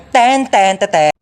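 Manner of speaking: ordinary talk, nothing marked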